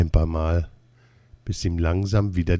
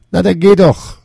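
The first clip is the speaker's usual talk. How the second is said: sharp, much higher and louder snap; snippy; 11 semitones higher, 7 dB louder than usual. A narrator's reading. Roommate snapping at Dieter to just go, snippy. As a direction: snap it at him, irritated. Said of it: "sagt patzig zu ihm".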